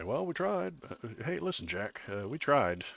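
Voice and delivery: a hint of a folksy Biden shrug; Biden impression. Mocking Biden telling voters they tried, lightly voiced. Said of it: "not a very good Biden impression".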